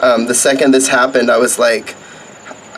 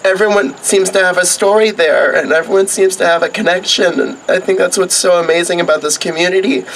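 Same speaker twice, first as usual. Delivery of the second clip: choked up with tears, higher; after crying; 5 semitones higher than usual. Real, not acted. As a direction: voice breaking, pitched up.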